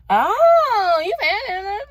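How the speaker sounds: high, loud, squealed mock awe; mock impressed gasp. People reacting to her Buckhead address, squealing high and loud. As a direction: squeal it like someone dazzled by Buckhead. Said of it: "And they be like".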